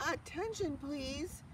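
loud and firm. A narrator's reading. Pig calls out firmly to the hidden friend. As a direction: announce it firmly to the barn. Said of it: "he said in a loud, firm voice".

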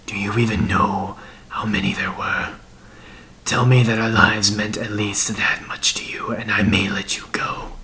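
breathy half-whispered demand; menacing dead man, bitter. Acted. Dead man demanding an answer from his killer, whispered bitterness. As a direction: press him in a hoarse-whispered, haunted voice.

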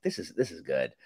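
ordinary talk

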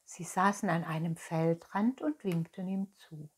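ordinary delivery

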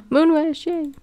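high, happy burst on spotting a friend; excited greeting. Real, not acted. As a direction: squeal the name with delight. Spots a favourite cat.

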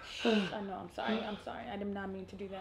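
hushed, low, tender comforting; soft, low murmured apologies; soothing apology. Real, not acted. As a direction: soothe your hurt partner very softly. Comforting upset partner after apology.

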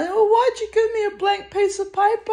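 very high, squealed retold complaint; high-pitched, put-out whine, played for laughs; mock indignant protest.

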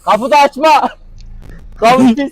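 sharp high loud warning; loud protest. Real, not acted. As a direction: yell at him to stop. (yüksek sesle itiraz)

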